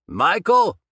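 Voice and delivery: loud, high shouted question; shouted back. A narrator's reading. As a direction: yell the name back. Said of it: "Dobbs shouted back".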